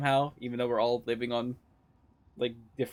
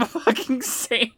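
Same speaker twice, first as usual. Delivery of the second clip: laughing; 10 semitones higher, slower than usual. Real, not acted. Laughing agreement with chat.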